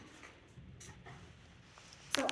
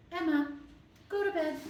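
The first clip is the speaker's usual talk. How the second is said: raised, higher call to the dog; firm command; 6 semitones higher, 6 dB louder than usual. Shown as a demonstration. Trainer calling the dog to bed, firm and raised. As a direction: call her name out firmly. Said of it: "I'm gonna say".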